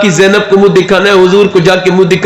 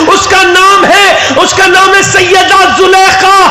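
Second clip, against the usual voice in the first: preaching at full force; 11 semitones higher than usual. Acted. Preacher to the gathering, crying out at full force. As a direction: pour it out to the crowd as a rising wail. Preacher's climax about the shrine.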